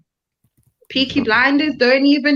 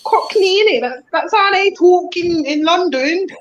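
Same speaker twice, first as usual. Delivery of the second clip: slightly higher, hammed-up Cockney; awful Cockney; 5 semitones higher than usual. Awful Cockney accent put on for friends. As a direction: overdo the Cockney on purpose. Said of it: "that awful Cockney fucking accent".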